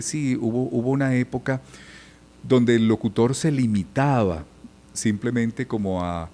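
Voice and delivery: normal talking voice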